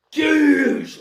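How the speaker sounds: high sharp goosh kick noise; comic-book kick whoosh; impact sound. Acted. Impact sound effect mid-fight.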